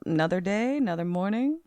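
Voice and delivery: a hint of an old man, softly; quiet, easygoing musing to himself; lightly voiced old man. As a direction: toss it off softly as an imagined old-timer. Imagined contented old man's daily routine.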